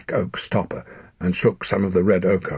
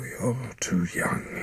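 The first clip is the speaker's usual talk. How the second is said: slow, soft grieving whisper; sorrowful whisper; 6 semitones higher, 5 dB quieter, slower than usual. Acted. Dying father grieves his son is too young, in a slow whisper. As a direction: breathe it out with sorrow.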